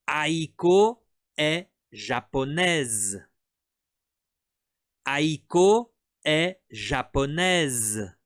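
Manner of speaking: very slow, every syllable laid out for students to repeat; slow model sentence. Shown as a demonstration. Teacher modelling a sentence for students to repeat, very slow. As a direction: say it slowly for the class to copy. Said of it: "répète comigo".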